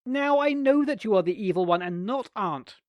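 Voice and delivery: loud, high triumphant shout; gleeful shout. A narrator's reading. Nicholas catching his aunt out, gleeful shout. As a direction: crow with triumph. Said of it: "shouted Nicholas gleefully".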